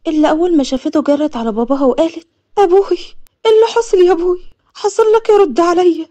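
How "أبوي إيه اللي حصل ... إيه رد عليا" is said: high, loud, distraught pleading; acted frantic cry to a father; panicked daughter. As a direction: beg father to answer, voice raised. Daughter runs to her dying father.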